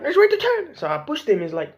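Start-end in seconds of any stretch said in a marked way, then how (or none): 0.0-0.8 put-on squeaky high kid voice; whiny kid voice. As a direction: whine like a bossy kid.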